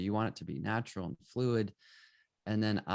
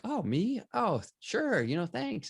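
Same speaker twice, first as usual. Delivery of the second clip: much higher, pleasantly surprised; flattered ordinary person; 12 semitones higher, slower than usual. Retold or imitated. Ordinary passerby asked for a portrait, high and flattered. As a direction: act bashful and pleased at being picked.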